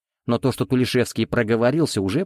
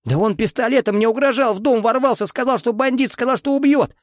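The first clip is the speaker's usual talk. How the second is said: hysterical; 12 semitones higher than usual. Acted. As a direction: scream it in hysterics. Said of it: "Бился в истерике Тулешевский".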